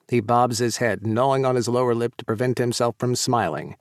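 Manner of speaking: ordinary delivery, nothing marked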